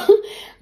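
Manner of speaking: quick, high little laugh; a brief, high-pitched laugh; short laugh. Real, not acted. Laughs after apologizing.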